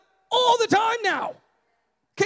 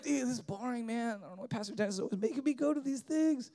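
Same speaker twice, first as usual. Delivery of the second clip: put-on bored teen; 7 semitones lower, 11 dB quieter, faster than usual. Bored youth mocked by preacher.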